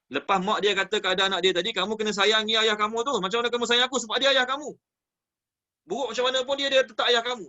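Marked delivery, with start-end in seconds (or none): 1.7-7.4 raised pitch, pressing the point; higher-pitched, insistent parental advice; insistent motherly urging. Acted. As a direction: urge it firmly like a mother. Mother insisting to her son.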